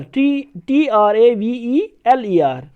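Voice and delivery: slow letter-by-letter dictation; slow teacher spelling. Shown as a demonstration. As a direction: spell each letter slowly. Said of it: "American यहाँ पे है traveller".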